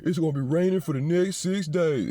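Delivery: news reporter. Retold or imitated. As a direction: announce the forecast like a TV anchor. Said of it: "And then the news over there".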